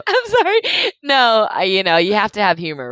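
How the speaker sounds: high and loud, still laughing; laughing it off after a joke; laughing apology. Real, not acted. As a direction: apologize through real laughter.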